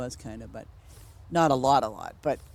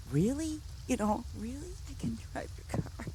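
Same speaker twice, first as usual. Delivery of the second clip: disbelieving; 9 semitones higher, 7 dB quieter than usual. Retold or imitated. Disbelief at being allowed to drive.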